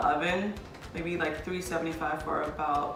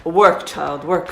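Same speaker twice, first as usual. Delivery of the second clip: mock stern order; 10 dB louder, much slower than usual. Teasing her cooking partner.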